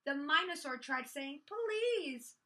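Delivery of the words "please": high, drawn-out plea; pleading. A narrator's reading. Minosaur begging the dinos to play, pleading. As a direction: beg on the one word.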